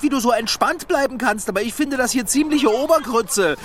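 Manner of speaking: ordinary talk, nothing marked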